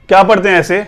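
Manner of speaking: ordinary talk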